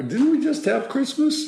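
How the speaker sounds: high, squeaky disbelief; playful astonishment; mock disbelief. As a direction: pitch it way up, amazed how fast the year went. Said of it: "Christmas comes around like".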